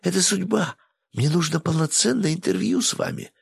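high eager begging; plead, rising with excitement. Acted. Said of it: "взмолилась журналистка".

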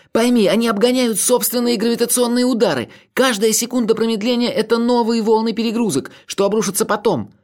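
high, urgent frightened plea; fearful pleading. A narrator's reading. Narrator warns Olga of overloads, high fearful pleading. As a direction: implore at a high pitch, panicking. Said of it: "молил я, охваченный страхом".

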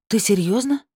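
taken-aback gasp of a question. A narrator's reading. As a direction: ask in amazement, pitch lifting. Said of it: "ахнула она".

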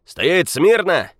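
loud, slow barked order; shouted command. Acted. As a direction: bellow it like a photographer. Photographers shouting at passengers.

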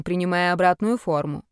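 ordinary delivery

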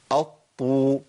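"الطو" as a held double ط into long u; heavy "aṭ-ṭuu"; doubled ṭ with damma. Shown as a demonstration. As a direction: press the ط, then round it. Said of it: "الحالة السادسة".

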